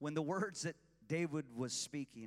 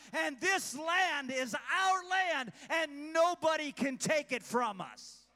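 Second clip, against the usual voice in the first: loud, high, shouted sermon; preaching at full force; 9 semitones higher, 8 dB louder than usual. Real, not acted. Pastor shouting covenant truth to his church. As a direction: drive it home to the congregation.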